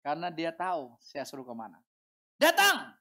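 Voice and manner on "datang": high, loud, abrupt summons; commander's order. Acted. Officer summoning his subordinate, shouted command. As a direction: call the subordinate in with full authority.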